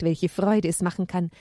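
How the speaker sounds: plain reading voice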